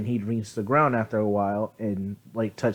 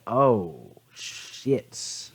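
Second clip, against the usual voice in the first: low "oh" trailing into a voiceless whisper; mock stunned realization; 4 semitones lower, 4 dB quieter than usual. Staff shown the clan banner, mock stunned. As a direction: let it sink in, acting dumbstruck.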